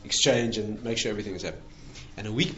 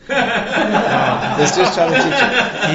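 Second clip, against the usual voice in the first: slow, higher and louder, laughing through it; laughing mock lawyer; 8 semitones higher, 10 dB louder, much slower than usual. Rabbi mocking the suspicious lawyers, laughing. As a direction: drag it out, cracking up at the lawyers' suspicion. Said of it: "the lawyers had told the guy".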